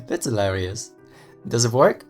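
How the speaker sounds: chuckle, then an amused remark; amused friend, chuckling. Acted. Friend finds the ham radio hilarious, chuckling. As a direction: laugh first, then ask with interest.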